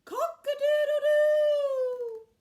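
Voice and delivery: crowed high, loud and drawn out; rooster crow. A narrator's reading. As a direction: show off a grand cock-a-doodle-doo. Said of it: "showed off his magnificent call".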